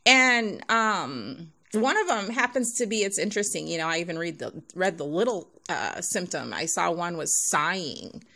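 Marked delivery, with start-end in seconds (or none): none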